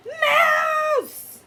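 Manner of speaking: very high, loud shriek; alarmed shriek. Acted. Cats spotting a mouse in the lunchbox, shrieking. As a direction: scream it out in shock.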